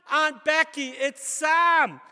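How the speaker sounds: high, very loud holler; shouting to be heard. Acted. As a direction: shout it to a deaf old aunt.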